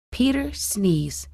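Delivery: plain reading voice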